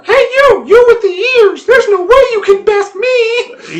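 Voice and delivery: high squeaky taunting goblin voice; jeering taunts. Acted. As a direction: squeak out a cheeky jeer. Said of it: "heard a little voice like this taunting her".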